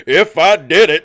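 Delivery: loud mock quote of the title; mocking exclaim. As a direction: throw the title out in disbelief.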